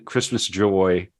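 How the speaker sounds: ordinary talk, nothing marked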